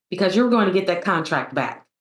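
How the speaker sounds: usual speaking voice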